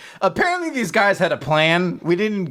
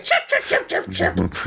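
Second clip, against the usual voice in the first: squeaky chirping cackle, mock evil; maniacal bird laugh; 8 semitones lower than usual. Evil bird laughing at the heroes, squeaky chirp cackle. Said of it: "starts laughing maniacally".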